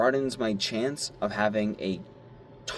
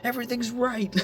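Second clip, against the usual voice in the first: very high gleeful cry; excited. Retold or imitated. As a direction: cry it out in pure joy. Gleeful reply to boss.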